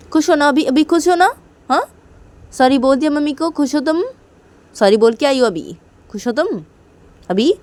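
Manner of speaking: high, loud mocking are you happy now; sarcastic taunt. Sarcastic taunt after the apology.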